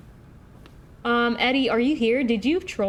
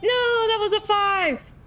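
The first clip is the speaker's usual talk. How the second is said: dismayed cry; 13 semitones higher, 4 dB louder than usual. Real, not acted. Streamer seeing a wrong number go in, in a dismayed cry. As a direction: wail in protest at the mistake, pitched way up.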